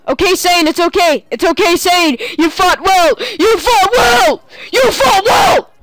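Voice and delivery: high, loud wailing farewell; mock-mourn the fallen unit; hammed-up grief. Player mock-mourning a fallen unit, high hammed-up wail.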